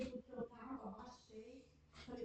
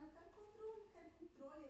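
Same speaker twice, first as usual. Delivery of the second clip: put-on higher, whiny child begging, fading quieter; crying child's demand; 6 semitones higher, 7 dB quieter than usual. Mother retelling her crying daughter, mild whiny child voice. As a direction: mimic a little girl wailing for the remote. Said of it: "e aí ela chorando".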